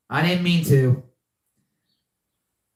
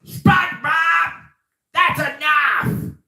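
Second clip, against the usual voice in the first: Squidward impression; 12 semitones higher, slower than usual. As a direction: bark his name like a fed-up neighbor. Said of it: "I bet you could do a good Squidward".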